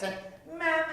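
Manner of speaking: ordinary talk, nothing marked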